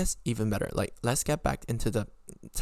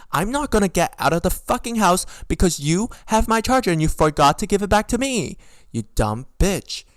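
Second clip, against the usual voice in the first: high, indignant rant building louder; angry yelling; 7 semitones higher, 5 dB louder than usual. Real, not acted. Yelling at her on the podcast over the charger, angry. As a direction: build from incredulous questions into a yell. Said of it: "I'll, like, yell at Vicky on this podcast".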